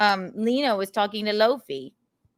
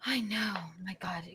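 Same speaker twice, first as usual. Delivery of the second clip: awed whisper; 2 semitones lower, 14 dB quieter than usual. Real, not acted. Awed whisper after guest praise.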